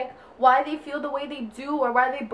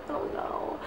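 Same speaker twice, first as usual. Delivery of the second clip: breathy whispered I don't know; 11 dB quieter than usual. Real, not acted. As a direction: drop to a whisper, giving up. Repeats herself, then whispers.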